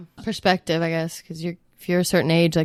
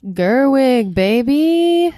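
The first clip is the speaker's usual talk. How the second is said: loud, rising cheer; high, loud, cocky shout; triumphant call; 6 semitones higher, 6 dB louder, much slower than usual. Real, not acted. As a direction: belt it out like a sure winner. Shouting out a quiz answer.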